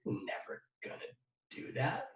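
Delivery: quiet, murky voice of self-doubt; the little voice in the back of the head sneering; inner critic voice. Retold or imitated. The speaker's doubting inner voice, quiet and murky. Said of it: "that little voice that goes".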